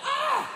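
high-pitched yelp of alarm; short comic shriek; mock scream. Man caught in a big earthquake, screaming. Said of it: "That was a fuck me moment".

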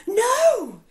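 high, loud shout; crowd yell. Acted. Crowd of prairie dogs yelling to stop Pip. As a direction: cry out in alarm. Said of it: "the crowd yelled".

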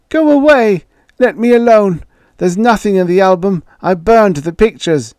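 high, loud frightened cry; frightened old man shouting. Acted. Ewing shouting at Joey to go away. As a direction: push him away with a shrill old shout.

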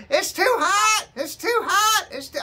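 high, loud crying protest; imitated crying wail. Son mimics mom wailing the water's too hot, high crying yell. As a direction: wail it like she's being scalded.

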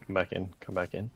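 ordinary delivery

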